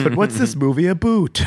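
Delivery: mock Canadian "about" said as "aboot"; Canadian accent. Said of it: "go right into Canadian".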